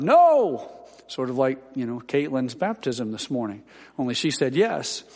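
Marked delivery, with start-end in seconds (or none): 0.0-0.7 loud, high-pitched shouted objection; sudden raised-voice outburst, imitated. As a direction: shout it out like an objecting church member. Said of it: "stood halfway up and shouted".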